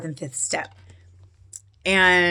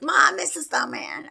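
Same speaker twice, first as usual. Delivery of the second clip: put-on high, sing-song kids' taunt; mock kid voice chanting it; teasing kids; 8 semitones higher than usual. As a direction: tease mom like her kids did. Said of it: "the kids were like".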